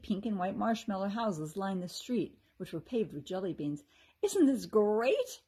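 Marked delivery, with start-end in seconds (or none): 4.2-5.4 slow, loud, higher happy cry; gushing with joy at the candy; thrilled exclamation; delighted. A narrator's reading. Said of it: "Kirsty laughed".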